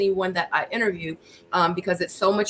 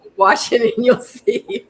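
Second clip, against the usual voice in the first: laughing; 4 semitones higher, 7 dB louder, slower than usual. Real, not acted. Laughing to new viewers.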